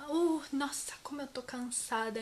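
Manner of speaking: soft, weary sigh of a line; acted tiredness. Shown as a demonstration. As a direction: act worn out, letting the voice sink. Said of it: "Agora eu tô cansada".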